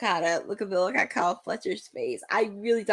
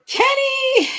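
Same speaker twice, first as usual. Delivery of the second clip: higher, louder call of the name; excited shout; 5 dB louder than usual. Real, not acted. Spotting Kenny on screen, calling out excitedly.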